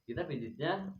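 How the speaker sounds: ordinary delivery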